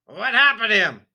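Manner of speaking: loud, high alarmed outburst; spluttering alarm. A narrator's reading. Father spluttering in alarm at the nose story, loud high blurt. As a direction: blurt it out in panic. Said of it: "he spluttered".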